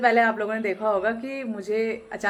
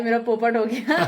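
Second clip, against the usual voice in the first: sheepish giggle. Real, not acted. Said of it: "फिर अपने में ही हंसने लगी मैं".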